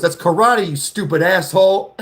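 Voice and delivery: lightly voiced smart-aleck student insulting; snotty kid voice. Other kid mocking the dumb one, bratty and high. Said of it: "the other kid goes".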